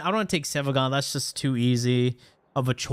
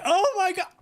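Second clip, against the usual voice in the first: high-pitched grandma getting carried away; overexcited grandma. Acted. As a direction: play a grandma gasping in sudden delight. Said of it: "getting her way too excited".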